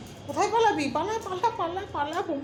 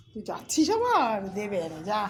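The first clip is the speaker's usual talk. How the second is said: usual speaking voice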